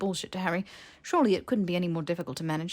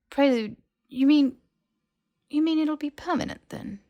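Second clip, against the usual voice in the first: alarmed disbelief; 9 semitones higher, 5 dB louder than usual. Acted. Harry alarmed at permanent curse.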